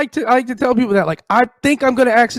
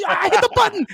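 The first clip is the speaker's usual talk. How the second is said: mock sister voice; 12 semitones higher than usual. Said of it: "She's like".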